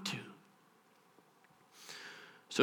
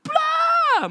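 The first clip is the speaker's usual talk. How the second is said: high, drawn-out "blah" yell; mock big-moment blare. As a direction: blurt it out like a fanfare. Mimes a big explosive moment.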